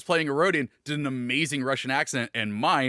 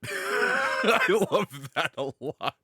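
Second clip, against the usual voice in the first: laughing delight; 8 semitones higher, much slower than usual. Real, not acted. Co-host reacting to a pitched idea, laughing delight.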